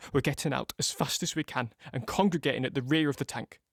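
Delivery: ordinary talk, nothing marked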